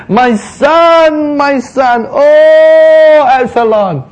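high, loud wailing cry of grief, drawn out slowly; anguished lament. Acted. David mourning his dead son, high loud grieving wail. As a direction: cry out like a father who lost his son. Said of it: "David in verse thirty-three says".

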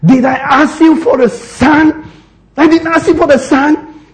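high, accusing protest; blaming. Retold or imitated. Bereaved woman confronting the man of God, acted blame. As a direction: throw it back at him in an acted, high-pitched complaint. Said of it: "he was really blaming the man of God".

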